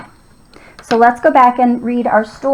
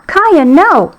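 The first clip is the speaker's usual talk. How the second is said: high, falling mock yell; mild yell; 6 semitones higher, 3 dB louder than usual. A narrator's reading. Owner to dog jumping at a frog, mild yell. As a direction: call the dog off, higher but not shouted. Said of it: "I yelled".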